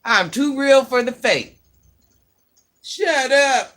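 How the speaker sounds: fired-up comeback; loud clapback. Real, not acted. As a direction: snap back loud and proud. Heated clapback at rude commenter.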